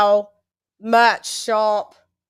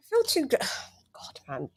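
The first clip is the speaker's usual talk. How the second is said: whispered groan at the glare; soft annoyed whisper; exasperated whisper; 4 semitones higher, 6 dB quieter than usual. Real, not acted. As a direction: sigh it out under breath.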